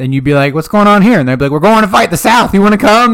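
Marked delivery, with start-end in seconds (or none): 0.5-1.2 high, loud mock question; curious townsperson. Acts a townsperson asking. 1.5-3.1 high, loud mock rallying cry; recruiter calling out. Marching troops calling a recruit.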